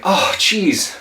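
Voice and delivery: mock dismay at being tricked; dismayed witness. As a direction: play the burned, regretful witness. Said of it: "you're going like".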